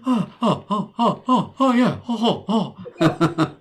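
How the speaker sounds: high, stuttered run of ohs; Michael Cera. Acted. Host fooling around between posts, high celebrity impression. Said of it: "That sounded like Michael Cera".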